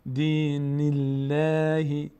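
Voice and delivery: slow chant, light L in "الله"; thin light Allah. Shown as a demonstration. Teacher repeating after kasra, L kept thin. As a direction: stretch "الله" with a thin, front L.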